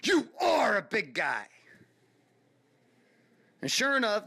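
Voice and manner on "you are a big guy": angry yell. Retold or imitated. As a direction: bark it right in the kid's face. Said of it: "and yells into my face".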